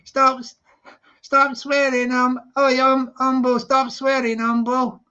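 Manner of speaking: badly faked Scouse accent, feeling for the words; attempted Scouse. Streamer mimicking Expo telling Humble off, attempted Scouse. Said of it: "I'll try and do a Scouse voice".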